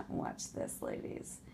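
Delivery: soft unvoiced sly whisper; smirking whisper. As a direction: whisper like she has a trick ready.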